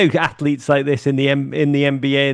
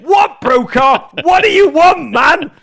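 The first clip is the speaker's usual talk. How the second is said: loud, high, hammed-up outburst; exasperated shout; 13 semitones higher, 6 dB louder, slower than usual. Interrupted guest snaps at the anchor, exasperated mock shout. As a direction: shout back in mock exasperation, drawn out and climbing.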